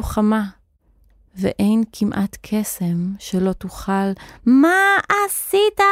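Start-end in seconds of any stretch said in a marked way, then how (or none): 4.5-5.8 very high, drawn-out shriek; screamed accusation. A narrator's reading. Wizard screaming at Nochash about the egg, horrified shriek. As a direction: scream it high, stretching the words. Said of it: "צרח המכשף על נוחש".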